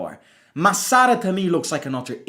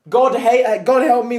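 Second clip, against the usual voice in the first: mock pleading to God; 7 semitones higher, 6 dB louder than usual. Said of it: "that Michelangelo painting going".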